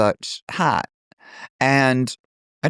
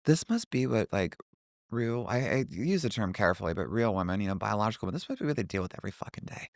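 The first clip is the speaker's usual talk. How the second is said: low, very soft aside; hushed realization; 4 semitones lower, 10 dB quieter than usual. Retold or imitated. Stared at walking back to car, hushed realization. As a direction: murmur it like a private uneasy thought.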